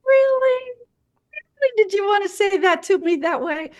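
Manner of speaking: very high-pitched comic incredulity; funny. Acted. Nurse first answering a rude surgeon, jokey mock disbelief. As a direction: put on a silly high voice to challenge them playfully. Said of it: "I try it funny first time".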